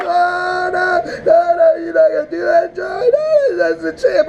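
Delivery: high, crying no no no; mock crying. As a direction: wail in despair. Pleading as fan in recap.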